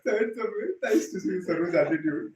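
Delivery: loud, helpless amusement in the words; real laughter through the sentence; talking through laughter. Real, not acted. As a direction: keep talking while still laughing. Still laughing after the punchline.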